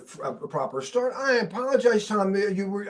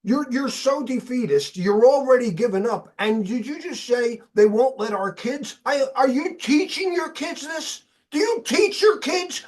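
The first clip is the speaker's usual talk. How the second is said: retold heated rant, climbing higher and louder; higher and louder with each question; mad outburst; 6 semitones higher, 4 dB louder than usual. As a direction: relive losing your temper at a defeatist friend. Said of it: "I just got mad and I said".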